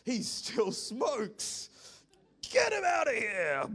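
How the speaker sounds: slow, loud, then a high yelled "Get him out of here"; mock angry Jesus. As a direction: build from stern to a shout, like a fed-up master. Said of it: "Jesus isn't going".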